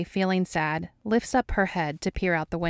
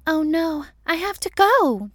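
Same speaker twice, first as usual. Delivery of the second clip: very high, loud put-on voice; hammed-up yell; 11 semitones higher, 7 dB louder than usual. A narrator's reading. Dana to Mulder after a phone rings, yelling. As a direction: squeal it out in alarm. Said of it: "Dana yells".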